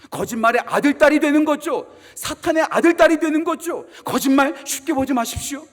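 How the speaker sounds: loud, rising pulpit warning; fiery sermon delivery; full-force preaching. Acted. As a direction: hammer home the warning like a preacher.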